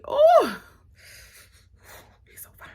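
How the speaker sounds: a high squeal, then a breathy whisper; swooning sigh. Acted. Swooning over the fine actor, squeal then whisper.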